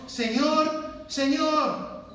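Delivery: each "Señor" pitched high and stressed; two rising, insistent cries of "Señor"; emphatic repeated Señor. Retold or imitated. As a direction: lean hard on "Señor, Señor". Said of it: "enfatizando esa palabra".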